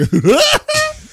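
very high, loud mock cackle; piercing, absurd burst of laughter; ridiculous laugh. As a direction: imitate an absurd shrieking laugh. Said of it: "had the most ridiculous laugh".

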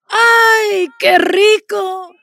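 loud, slow mock moan; mistress's hammed-up pleasure cry; lover moaning. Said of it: "La amante dice".

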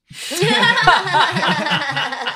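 high, loud laugh fit; loud burst of laughter. Real, not acted. The game master cracks up at his own stumble, laughing loudly.

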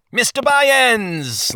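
drawn-out high call across the room. Acted. Lord greets the newcomer at the door, a long high call. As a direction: call his name as he walks in. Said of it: "Lord Turnabout called".

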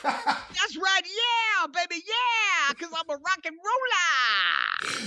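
slow, high rock and roll screech; drawn-out screechy singer impression. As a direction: wail it out like a mock rock star. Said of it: "my [name] rock and roll voice".

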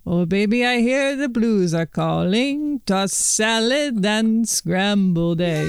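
hammed-up Russian accent, last line slow and drawn out. Singing the Frasier theme to the hosts in mock Russian accent. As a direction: croon it like a lounge singer in a mock Russian accent. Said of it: "a Frasier theme song in Russian accent".

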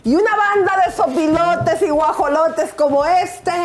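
high, slow, theatrical recital; grand poetry declamation. Pretend. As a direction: declaim it like a hammed-up stage poet. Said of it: "voy a bailar y voy a declamar".

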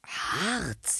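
breathy whispered "Harz". Acted. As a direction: breathe the word out softly. Savouring the suggested destination. (geflüstertes "Harz")

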